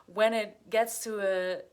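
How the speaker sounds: ordinary talk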